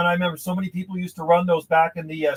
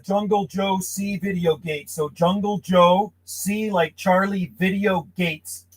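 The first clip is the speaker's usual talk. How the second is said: slow, careful spelling out of a name; slow dictation; slower than usual. Shown as a demonstration. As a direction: spell it out slowly for the listener. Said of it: "Hey, what's your YouTube channel?".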